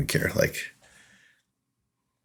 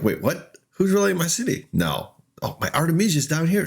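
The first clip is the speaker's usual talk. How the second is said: mock panic, jumping high in pitch; flustered retold alarm; alarmed disbelief; 10 semitones higher than usual. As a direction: replay the sudden realization, voice shooting up. Retells panic at city being rallied.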